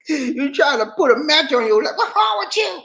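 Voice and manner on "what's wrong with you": high, exasperated calling-out; pitched-way-up mock scolding; incredulous call-out. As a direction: shout up at him in disbelief. Calling out at the mugshot.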